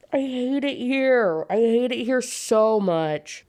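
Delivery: high, slow, drawn-out mock wail; exaggerated despair. Real, not acted. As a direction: moan it out in hammed-up misery. Mock despair over the romance.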